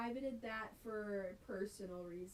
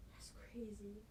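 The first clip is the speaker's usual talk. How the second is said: breathy mock-shocked whisper; gasp, then whisper it in awe; 10 dB quieter than usual.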